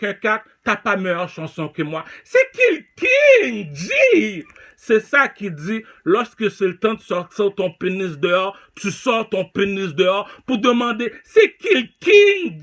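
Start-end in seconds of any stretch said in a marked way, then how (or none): 2.2-4.4 very high, loud, slow yell; drawn-out shout. Real, not acted. Rapper taunting rivals who is the king, slow loud yell. 11.2-12.6 very high, loud, slow yell; drawn-out shout. Real, not acted. Rapper taunting rivals who is the king, slow loud yell.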